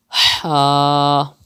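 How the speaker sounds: "Ha" from the middle of the throat, drawn out. Shown as a demonstration. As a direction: hold "Ha" with Arabic throat friction, no kh scrape. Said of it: "Pronounce it from the middle part of your throat".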